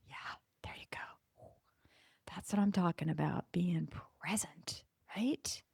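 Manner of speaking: soft unvoiced whisper. Real, not acted. Sharing her lesson on presence with listeners, whispering. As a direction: breathe it out, intimate. Hushed reflective message.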